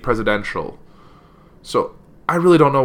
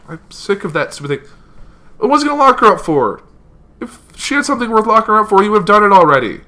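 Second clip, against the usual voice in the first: exasperated outburst; 9 semitones higher, 4 dB louder than usual. Real, not acted. Frustrated rant about chant.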